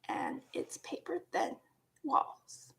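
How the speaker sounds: ordinary talk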